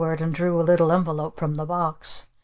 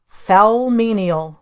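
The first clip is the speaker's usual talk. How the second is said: slow stern command; 3 semitones higher, 5 dB louder, much slower than usual. Acted. Witch aunt ordering servant.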